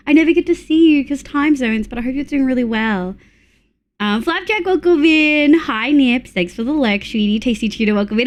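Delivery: ordinary talk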